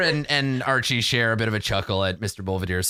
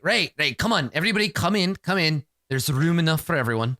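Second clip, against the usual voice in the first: higher-pitched, hurrying invitation; calling everyone in; 6 semitones higher than usual. Acted. Host waving everyone into the room, calling them in. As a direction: usher a group inside. GM voices character herding everyone in.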